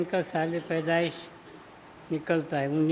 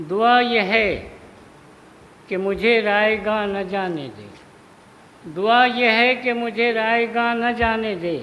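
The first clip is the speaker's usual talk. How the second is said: raised, sustained mushaira declamation; prayer poem recitation; 3 semitones higher, 6 dB louder than usual. Acted. Poet praying not to be wasted, declaimed recitation. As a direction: declaim the plea line by line, with pauses.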